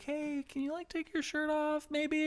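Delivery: a nervous director asking very gently; high squeaky awkward request; timid high voice. Retold or imitated. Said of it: "and he was like".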